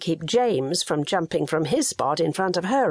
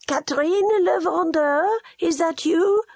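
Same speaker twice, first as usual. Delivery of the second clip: put-on old woman calling; 12 semitones higher than usual. A narrator's reading.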